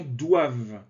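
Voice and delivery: "doivent" said "doiv", the ENT dropped; silent ENT. Shown as a demonstration. Teacher warning learners that "doivent" is said "doiv". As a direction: end "doivent" on the v sound. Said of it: "On ne prononce pas le E N T".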